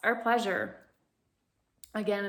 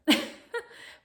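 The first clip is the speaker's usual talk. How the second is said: light laugh. Real, not acted. Laughs while teaching.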